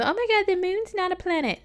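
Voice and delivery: high put-on whiny nitpicker voice; mocking objector. Mocking people who object to astrology talk, high put-on voice.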